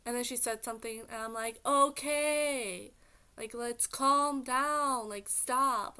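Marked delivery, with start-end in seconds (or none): 1.7-6.0 louder, slow, firm and higher; loud, slow, trying to settle mom down; loud plea to calm down. Retold or imitated. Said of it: "I said it, like, loud".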